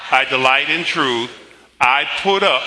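declamatory, deliberate group recitation; congregational confession. Acted. Pastor leading the church in its confession, emphatic recitation.

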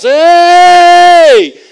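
loud, high, drawn-out shout; attention-getting yell. Acted. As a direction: holler to get someone's attention over a crowd. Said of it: "They're making some noise".

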